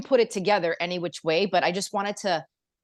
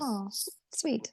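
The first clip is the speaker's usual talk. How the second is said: very soft, higher-pitched coo; touched; 4 semitones higher, 10 dB quieter, slower than usual. Real, not acted. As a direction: melt softly at a kind compliment. Touched by a compliment.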